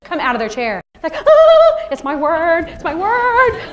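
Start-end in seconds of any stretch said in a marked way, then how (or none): 1.2-3.7 high, loud mock squeal of delight; drawn-out, hammed-up childish glee; excited kids. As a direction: imitate thrilled kids leaping up to claim their word. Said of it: "you know what the kids do".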